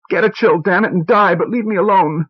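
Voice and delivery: high, furious outburst; angry shout. A narrator's reading. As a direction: spit it out in rage. Wife yelling, high.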